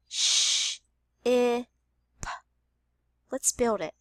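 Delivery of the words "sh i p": "sh, I, p" as separate sounds, "sh" a voiceless hiss; sounded out. Shown as a demonstration. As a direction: say "sh, I, p" one sound at a time. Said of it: "The first sound is".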